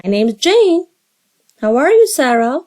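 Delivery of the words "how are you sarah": usual speaking voice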